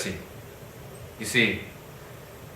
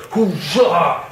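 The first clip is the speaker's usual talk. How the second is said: mock battle cry; 6 semitones higher than usual. Retelling a fighter's battle cry.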